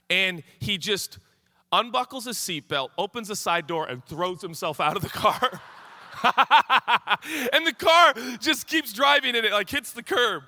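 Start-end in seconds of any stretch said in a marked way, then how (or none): 6.0-7.5 high, loud cackling burst; helpless laugh. Real, not acted. Pastor delighted by the man leaping out, loud laughing. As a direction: crack up at your own story. Cracking up at own story.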